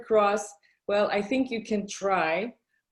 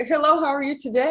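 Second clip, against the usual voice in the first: quick, higher, louder small-talk hello; cheery greeting; 6 semitones higher, 6 dB louder, much faster than usual. Retold or imitated. Driver greeting a passenger, imitated fast cheery hello. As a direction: rattle off a friendly service greeting. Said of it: "When they're like".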